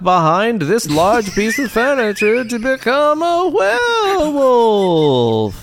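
high, slowing into a howling cry; werewolf transformation. Pretend. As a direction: stretch the last words into a howl.

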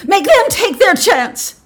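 high, loud, drawn-out demand; yelled. A narrator's reading. Wife demands others draw too, yelling. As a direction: yell each word out. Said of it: "Missus Hutchinson yelled".

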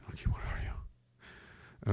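breathy whispered call, very slow. Real, not acted. Streamer whispering for a viewer's message. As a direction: whisper it like searching a dark room.

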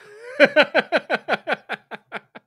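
high, loud rapid cackle; long run of helpless giggles; real laughing fit. Real, not acted. Host cracking up at sign-off, real laughing fit.

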